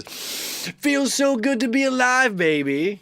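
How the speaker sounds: high, drawn-out exuberant cry; Ralph Smart. Retold or imitated. Vlogger imitating a favorite speaker's catchphrase, high and exuberant. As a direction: stretch it out high in joyful imitation. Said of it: "I always love how he says".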